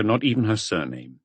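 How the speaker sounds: ordinary delivery, nothing marked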